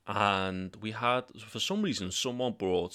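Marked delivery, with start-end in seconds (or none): none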